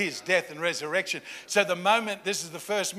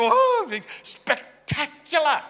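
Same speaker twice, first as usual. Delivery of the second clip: slow, higher and louder hammed-up awe; mock amazement; 9 semitones higher, 7 dB louder, much slower than usual. Preacher mocking wish for spectacle, slow hammed-up awe. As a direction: stretch it out like a dazzled fan.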